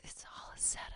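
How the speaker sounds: hushed conspiratorial whisper; breathy aside, as if sharing a secret. Real, not acted. Conspiratorial aside about a setup.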